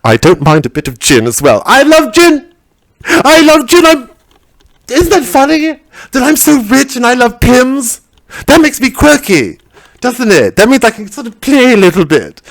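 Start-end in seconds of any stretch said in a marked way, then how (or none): 1.7-4.1 very high, gushing I love gin; high-pitched posh delight; gushing posh squeal. Acted. 4.9-12.4 high, preening toff voice; a hint of a quirky aristocrat; lightly voiced posh lady.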